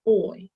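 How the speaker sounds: oi said with the mouth gliding from o to i; gliding diphthong. Shown as a demonstration. Teacher modelling the oi diphthong glide. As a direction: let oi slide. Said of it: "subtle movement in your mouth".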